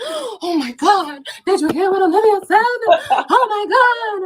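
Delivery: high, drawn-out scandalized gasp-talk, hammed up; mock shocked gossip. Mocking commenters gossiping about her, high scandalized drama. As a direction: overplay the gossiping hater clutching pearls.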